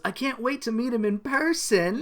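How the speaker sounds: lightly hammed-up eagerness; eager. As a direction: play it as dreamy anticipation. Acts out gushing Jem line.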